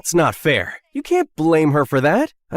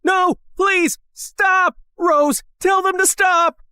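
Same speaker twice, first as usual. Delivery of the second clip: higher, pleading shout; desperate shout; 8 semitones higher, slower than usual. Acted. Matthew begs Rose to stop the men, desperate higher shout. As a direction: beg them to stop at full cry.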